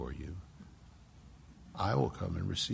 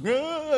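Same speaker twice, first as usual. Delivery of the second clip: falling gasp; 5 dB louder than usual. Acted. Falling into darkness, being caught.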